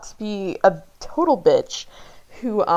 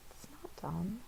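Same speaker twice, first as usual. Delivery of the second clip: whisper; 19 dB quieter, slower than usual. Acted. Dad comforting upset daughter.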